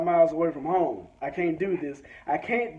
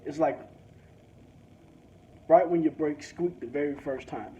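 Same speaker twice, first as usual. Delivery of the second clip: ordinary talk, nothing marked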